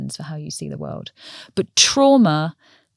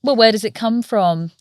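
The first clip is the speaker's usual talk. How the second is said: normal talking voice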